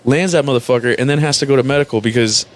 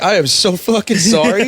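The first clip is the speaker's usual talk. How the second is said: hammed-up sympathy; 10 semitones higher than usual. Retold mock sympathy to Marine.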